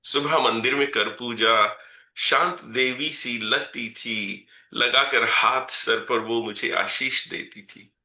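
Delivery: measured, rhythmic reading of verse; poem recitation. Acted. Poet reciting a poem about his mother to the audience, measured recitation. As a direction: recite each line and let it land.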